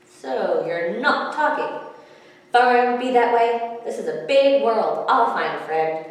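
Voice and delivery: the ignored fish gives up on the pebbles; huffy fish. A narrator's reading. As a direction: sulk it out loudly. Fish huffs at silent pebbles.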